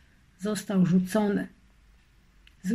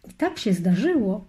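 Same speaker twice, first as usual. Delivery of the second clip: plain reading voice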